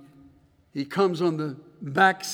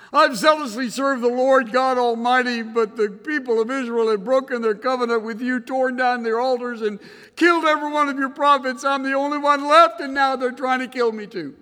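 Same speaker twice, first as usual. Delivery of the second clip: higher, louder, aggrieved rush of words; whining complaint; 8 semitones higher, 6 dB louder than usual. Retold or imitated. Elijah airs his grievances to God, higher and aggrieved. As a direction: pour out a self-pitying list of wrongs. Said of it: "Listen to it".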